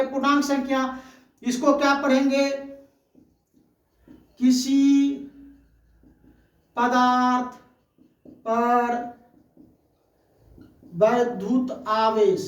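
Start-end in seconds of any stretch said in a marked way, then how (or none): 4.4-12.4 each word set apart by long pauses; slow, spaced-out teacher dictation. Shown as a demonstration. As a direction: dictate it word by word for note-taking. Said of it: "इसको हम पढ़ेंगे".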